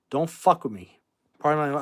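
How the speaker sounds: ordinary talk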